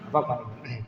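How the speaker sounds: "Ngapak maning" dropped soft and low as a rueful aside; muttered repeat. Shown as a demonstration. Teacher repeating his joke to himself, softly muttered. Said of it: "lanjutannya nanti ngapak".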